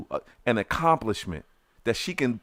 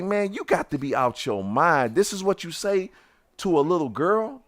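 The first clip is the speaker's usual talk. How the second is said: higher, disbelieving rebuke; outraged scolding; 6 semitones higher than usual. Retold or imitated. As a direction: scold him for hurting her. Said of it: "I say".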